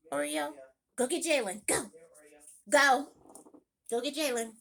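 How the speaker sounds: loud firm dog commands; commanding dog. Real, not acted. Mom sending the barking dog to her son, loud commands. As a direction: order the dog out firmly.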